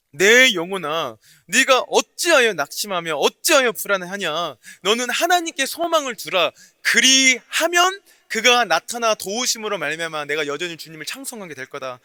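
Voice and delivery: loud, measured reading with the church; congregational scripture reading. Real, not acted. Preacher reads the psalm aloud with the congregation. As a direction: read aloud in unison, full voice. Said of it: "같이 한번 읽어보도록".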